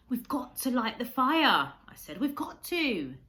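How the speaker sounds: insist, the rescue boat is slipping away; urgent insistence. A narrator's reading. Boy urgently pleads with Kensuke.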